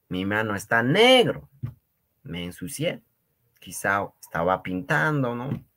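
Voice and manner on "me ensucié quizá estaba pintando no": normal talking voice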